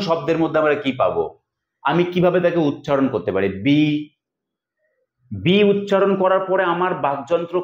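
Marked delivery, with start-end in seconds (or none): 3.6-3.8 বি said alone, then a stop; syllable on its own. Shown as a demonstration. Teacher splitting the word into syllables, বি as a separate syllable. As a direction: break off cleanly after বি. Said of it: "থামতে পারছে".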